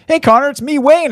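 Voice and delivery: high, friendly voicemail greeting; Gretzky impression. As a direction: greet Connor in a high, cheery voice. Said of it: "And it's like".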